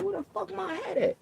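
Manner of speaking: yelling for the hat; frantic yell. Retold or imitated. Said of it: "I'm like".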